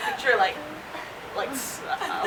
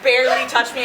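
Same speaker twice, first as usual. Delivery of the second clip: ordinary talk, nothing marked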